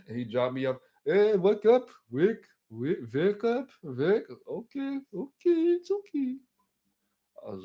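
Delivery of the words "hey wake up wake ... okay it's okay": high accented put-on voice; hammed-up high-pitched cabbie; cab driver waking passenger. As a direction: rouse a sleeper in a squeaky driver voice.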